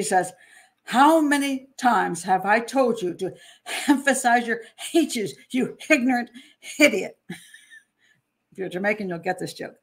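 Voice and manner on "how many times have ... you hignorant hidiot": mock-stern teacher saying hemphasize, hignorant, hidiot; angry teacher. Teacher tells pupil to emphasize H's, mock angry. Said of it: "the teacher gets very angry".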